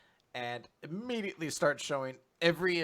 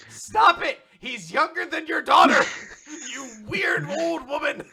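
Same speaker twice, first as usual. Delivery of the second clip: high, loud shout through laughter; exasperated yell; 12 semitones higher, 11 dB louder than usual. Retold or imitated. Daughter yells at her teasing mom, high loud shout.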